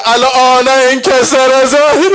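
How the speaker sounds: slow, loud mourning chant, voice strained with grief; wailing lament. Acted. Hussein mourning his fallen brother, slow loud lament. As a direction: cry it out like a broken man.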